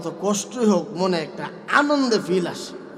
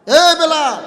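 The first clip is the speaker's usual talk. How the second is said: higher, louder call; shout; 11 semitones higher, 12 dB louder, slower than usual. Acted. Abu Bakr yelling at Bilal, loud call. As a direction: bark his name. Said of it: "এবার চিৎকার করছে".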